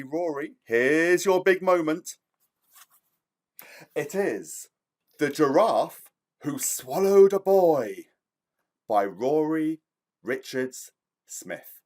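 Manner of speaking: usual speaking voice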